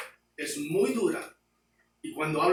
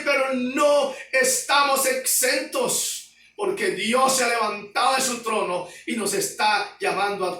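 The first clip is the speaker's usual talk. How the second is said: loud, high, impassioned sermon voice; fiery preaching; 7 semitones higher, 4 dB louder than usual. Acted. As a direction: thunder the warning at the congregation. Preaching at full force.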